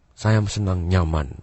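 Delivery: low, soft, slow with pauses; feeling-type speaker. Shown as a demonstration. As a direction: speak low and unhurried, as if feeling each word. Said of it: "Dia menggunakan ciri-cirinya". (nada rendah, pelan, penuh jeda)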